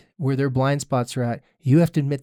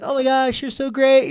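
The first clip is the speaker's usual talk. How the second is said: fake gushing friend; 9 semitones higher than usual. Mimicking fake online friends.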